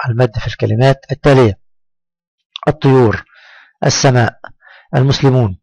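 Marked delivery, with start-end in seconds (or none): 2.7-3.2 الطيور said briskly, oo kept short; quick reading. Shown as a demonstration. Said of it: "أنا أنطق الكلمات بسرعة". 3.8-4.4 السماء said briskly, aa kept short; quick reading. Shown as a demonstration. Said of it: "أنا أنطق الكلمات بسرعة". 4.9-5.6 المسلمون said briskly, oo kept short; quick reading. Shown as a demonstration. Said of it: "أنا أنطق الكلمات بسرعة".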